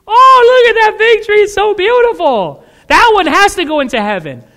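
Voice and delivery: high, loud gushing admiration; gushing admirer. Acted. Churchgoer admiring the leafy fig tree, high and loud with gushing awe. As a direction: gush over the pretty tree.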